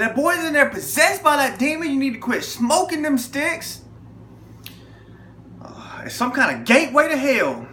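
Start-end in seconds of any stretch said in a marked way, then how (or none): 0.0-3.8 high, loud shaken warning; a hint of a frightened cop warning the dorm; alarmed warning. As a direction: scold them, scared and urgent. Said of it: "She said".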